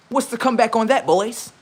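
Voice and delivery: usual speaking voice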